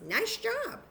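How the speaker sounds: put-on high, bright praise for a child. Shown as a demonstration. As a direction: cheer the kid on, way up high. Teacher praising while counting.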